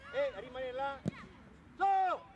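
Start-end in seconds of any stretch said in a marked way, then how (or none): 1.8-2.2 high loud call to push on; sharp shout. Real, not acted. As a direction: bark one word to get them moving. Allenatrice incita dal bordo campo. (urlo secco e acuto)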